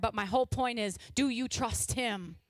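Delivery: usual speaking voice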